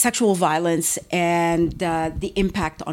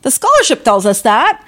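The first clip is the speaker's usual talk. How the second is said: sharply higher, louder retold reply, stressing of course; emphatic of course; 7 semitones higher, 7 dB louder than usual. To the women who advised her, a much higher, louder emphatic reply. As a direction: act it out as an obvious, emphatic agreement. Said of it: "And I said".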